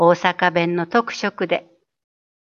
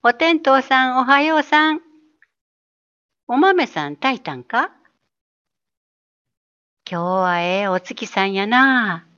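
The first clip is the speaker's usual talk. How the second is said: gentle, rounded Osaka dialect greeting, lightly voiced; soft Osaka dialect; 3 semitones higher than usual. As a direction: greet the sun and moon in easygoing Osaka dialect. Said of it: "丸っこい大阪弁。". (やわらかい大阪弁)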